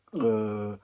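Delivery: loud, drawn-out "uh" of a frozen brain; blank panicked groan. Retold or imitated. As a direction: groan it loudly as if your mind went blank. Brain shut down, acted blank.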